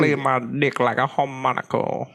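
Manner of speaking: a put-on rock singer voice; a cheesy rocker come-on. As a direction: purr it like a washed-up rock star. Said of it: "that's exactly how he talks".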